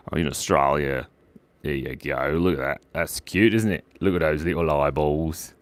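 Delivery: normal talking voice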